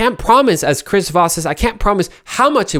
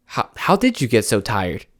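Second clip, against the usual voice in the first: low, softer, gentle ask; soft caring question; 6 semitones lower, 4 dB quieter than usual. Shown as a demonstration. Friend after a hard week, asked softly and kindly. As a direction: ask like you really care.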